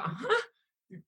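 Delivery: very high, laughing huh; shocked huh. Retold or imitated. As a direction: squeak it out in disbelief. Confused in headlock, laughing.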